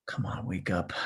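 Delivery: breathy whispered coaxing under the breath; whisper to the computer. Real, not acted. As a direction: hurry the sluggish machine along in a whisper.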